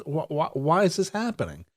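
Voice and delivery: usual speaking voice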